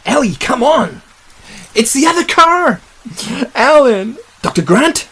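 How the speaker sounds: high-pitched searching calls; urgent calling. Acted. Searcher spotting the other car, calling urgently for Alan. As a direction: call out high for the missing.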